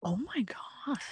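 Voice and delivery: breathy stunned whisper of disbelief; shocked whisper. Real, not acted. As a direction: gasp it out under the breath. Shocked listener reacts to story.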